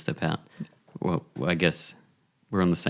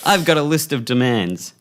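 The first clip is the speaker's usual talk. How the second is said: hostage demands; 8 semitones higher, 4 dB louder than usual. Acted. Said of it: "he's like".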